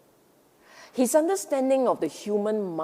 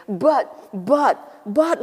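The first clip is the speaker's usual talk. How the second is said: whining voice; 3 semitones higher, 7 dB louder, much slower than usual. Pretend. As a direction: whine like a stubborn child. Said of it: "that whining voice in the background going".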